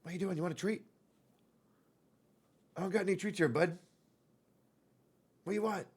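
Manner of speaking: soft, sweet questioning; gentle pet talk. Real, not acted. Owner asking cat about treats, soft pet talk. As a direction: ask a cat sweetly and quietly.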